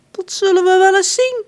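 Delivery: high, loud petulant prince; prince John. A narrator's reading. Prince John scoffing at the rich baker, high and loud. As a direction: puff up like a spoiled royal.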